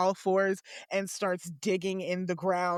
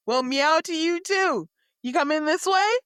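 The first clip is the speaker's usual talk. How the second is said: sweet talk to a cat; 8 semitones higher, 6 dB louder than usual. Acted. Garen greets the cat.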